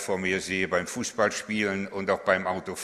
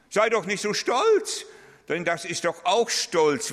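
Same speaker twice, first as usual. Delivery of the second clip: loud, much higher, slow; forceful rebuke; 10 semitones higher, 6 dB louder than usual. Real, not acted. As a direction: thunder it from the pulpit. Preacher rebukes false humility. (laute, hohe, langsame Ermahnung)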